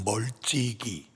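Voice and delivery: 멀찍이 drawn out slowly and low; slow emphasis. Shown as a demonstration. Preacher underlines the key word, slow and low. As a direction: stretch 멀찍이 out, lingering. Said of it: "분명하게 기록하고 있어요".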